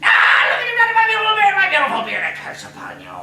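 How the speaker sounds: very high loud wail then curse; cursing dwarf. Acted. Dwarf curses the girls over his beard, shouting. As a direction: howl over the lost beard.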